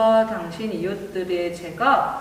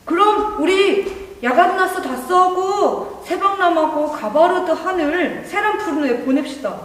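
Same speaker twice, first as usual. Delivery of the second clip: rallying crowd; 8 semitones higher, 6 dB louder than usual. Acted.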